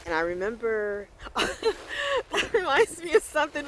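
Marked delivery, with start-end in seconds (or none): 1.4-3.6 laughing, high and slow. Real, not acted. Laughing as a memory interrupts her vlog. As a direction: breaks into a giggle at a memory. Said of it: "what made me laugh just now".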